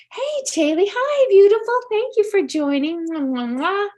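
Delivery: high, loud bright welcome to a new viewer; excited greeting. Real, not acted. Medium greets a viewer joining the live reading, high and excited. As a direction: sing out hello to someone arriving.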